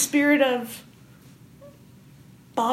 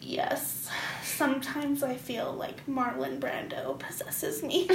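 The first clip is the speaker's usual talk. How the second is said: pretentious actor; 3 semitones lower, 10 dB quieter than usual. Pretend. Mock pretentious actor talk.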